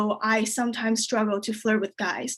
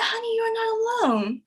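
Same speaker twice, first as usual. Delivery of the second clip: mock comforting; 11 semitones higher than usual. Comedian to her crying pregnant friend, mock comforting. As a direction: imitate consoling a friend, pitched way up.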